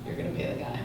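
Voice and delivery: ordinary talk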